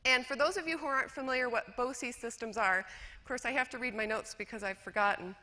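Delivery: ordinary delivery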